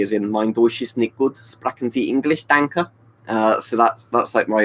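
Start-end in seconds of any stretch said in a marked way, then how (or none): 0.3-2.9 halting schoolbook German in an English speaker's mouth; beginner's German with an English tinge; bad German. Shown as a demonstration. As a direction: speak German badly, as a learner would. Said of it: "I can speak German very badly".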